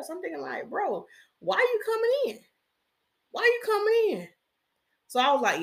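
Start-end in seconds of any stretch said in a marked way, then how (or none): 0.7-4.3 high-pitched incredulous questioning; mock-baffled protest, pitched way up; exasperated inner thought. Voicing her exasperated inner reaction.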